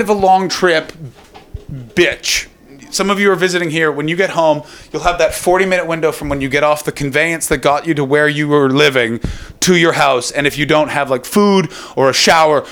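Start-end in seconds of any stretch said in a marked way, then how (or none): none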